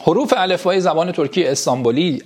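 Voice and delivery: ordinary delivery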